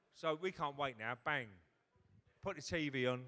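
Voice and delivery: usual speaking voice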